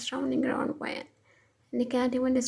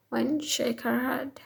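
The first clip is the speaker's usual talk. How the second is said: usual speaking voice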